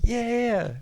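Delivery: high quick yeah-yeahs; lightly voiced hurried agreement; eager agreeing. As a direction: brush it off eagerly. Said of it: "And I was like".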